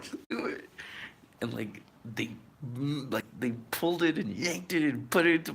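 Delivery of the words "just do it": whispered plea. Retold or imitated. Patient whispers to doctors to get it over with. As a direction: whisper just do it, no voice. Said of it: "I was just like".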